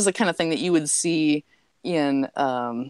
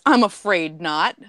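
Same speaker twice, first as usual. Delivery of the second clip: slow punchline; 3 dB louder, slower than usual. Retold or imitated. Punchline string disguised.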